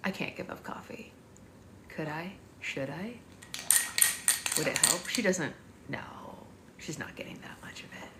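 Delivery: soft unvoiced musing to herself; whispered worry. Real, not acted. Mother whispers her worry about giving up coffee. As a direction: whisper the doubt under her breath.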